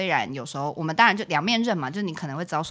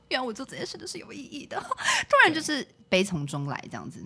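mock-tearful realisation; 8 semitones higher than usual. Retold moved feeling, laughing through it.

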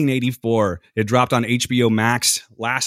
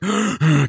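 sharp gasp, fighting for breath; gasp for air; 6 semitones higher than usual. Retold or imitated. Retelling breathless panic after the fire, sharp gasp. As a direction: gasp like you're choking on smoke. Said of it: "I literally was like".

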